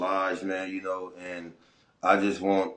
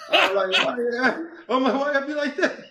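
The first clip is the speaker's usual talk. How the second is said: laughing through the words; can't hold back the laugh; 3 dB louder than usual. Real, not acted. Laughing retelling the officer fleeing.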